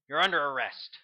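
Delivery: a loud, firm arrest call; commanding. A narrator's reading. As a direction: announce the arrest with authority. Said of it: "he commanded".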